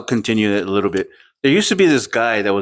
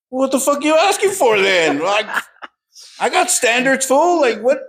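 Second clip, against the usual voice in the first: higher, exasperated mock rant; mock outrage; 11 semitones higher than usual. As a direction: play it up, indignant and incredulous.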